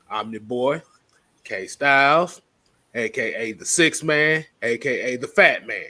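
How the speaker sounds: ordinary talk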